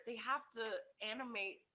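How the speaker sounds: usual speaking voice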